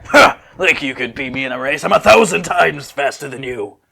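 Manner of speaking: mocking rival boast; boastful retort. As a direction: brag like you're untouchable. Sissel boasts back at Nani.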